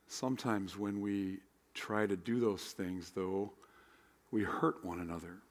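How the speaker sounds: prayer. Acted. Leads opening prayer.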